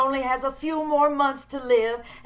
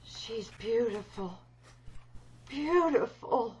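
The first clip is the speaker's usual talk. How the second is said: slow, soft, admiring murmur; soft gloating; 6 dB quieter, slower than usual. Acted. Disfigured woman softly admiring her unconscious victim. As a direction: savor the word slowly.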